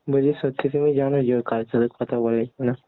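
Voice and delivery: ordinary delivery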